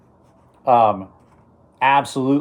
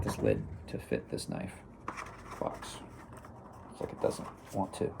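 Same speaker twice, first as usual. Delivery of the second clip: frustrated whisper; 17 dB quieter than usual. Real, not acted. Struggling with the lid.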